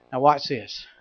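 ordinary delivery

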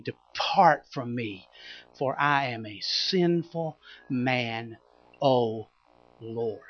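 slow, soft, broken confession; humbled plea. Acted. Peter kneeling before Jesus, slow soft ashamed plea. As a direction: say it on your knees, ashamed.

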